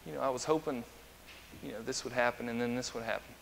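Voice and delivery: soft, understated disappointment; quietly let down. Acted. God looking at His creation's failings, quietly let down.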